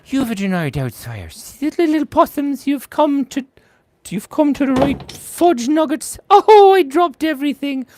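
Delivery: hammed-up Scottish nanny in the style of Mrs Doubtfire; a high, fluting Scottish granny voice; Mrs Doubtfire accent. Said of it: "Missus Doubtfire accent".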